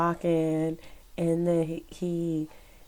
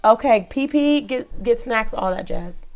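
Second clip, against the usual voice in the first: raised voice, called across the house; calling out; 4 semitones higher, 8 dB louder than usual. Real, not acted. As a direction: call out to her son in the next room. Calling out to her son.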